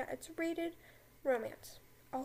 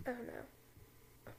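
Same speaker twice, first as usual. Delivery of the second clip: whispered "oh, no"; mock dread whisper; 11 dB quieter than usual. Hermione dreading detention with Snape, whispered.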